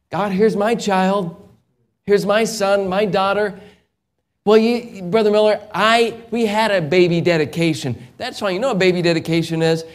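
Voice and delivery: rising pulpit fervor; fervent preaching. Acted. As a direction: preach it out to the congregation. Preaching at full force.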